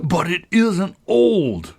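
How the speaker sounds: loud, high, drawn-out tantrum cry; slow, furious howl; great fury. A narrator's reading. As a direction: protest in an outraged wail. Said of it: "cried in a greater fury than ever".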